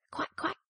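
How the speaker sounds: high little quacks; ducklings quacking. A narrator's reading. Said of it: "they said".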